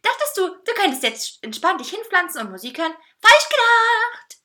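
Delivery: gleeful high-pitched jeer; mocking taunt. A narrator's reading. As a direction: crow it triumphantly and stretch the punchline. Cake taunts the narrator mockingly.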